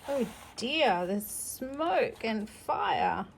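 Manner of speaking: ordinary delivery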